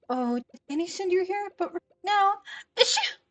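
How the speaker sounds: high, loud, sneezing shopkeeper voice; sneezy Dorothy. Acted. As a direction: play a sick lady mid-sneeze. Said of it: "this is Dorothy".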